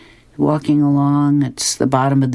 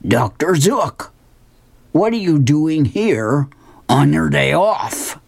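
Hagar; 3 semitones higher, slower than usual. Acted. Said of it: "and he says".

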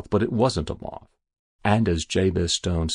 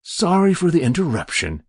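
lightly voiced polite apology, higher and louder; unruffled stranger; 9 semitones higher, 6 dB louder than usual. Stranger apologizing after catching the creature, unruffled. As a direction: brush the incident aside politely.

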